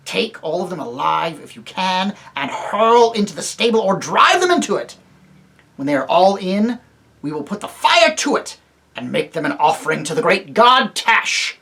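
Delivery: a ruthless captain ordering his men; cruel commander. Acted. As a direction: order the slaughter coldly. Tarkaan giving cruel orders.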